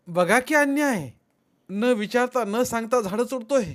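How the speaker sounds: high, loud protest; indignant complaint. A narrator's reading. Rama complains to Nana about the tree cutting, loud indignation. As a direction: appeal loudly against an injustice.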